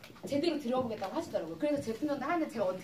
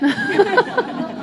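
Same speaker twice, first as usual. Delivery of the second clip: big loud cackle, higher; loud laugh; 7 semitones higher, 16 dB louder than usual. Real, not acted. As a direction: laugh hard at her own joke. Laughing at own boast. (자기 미모 자랑에 터진 큰 웃음)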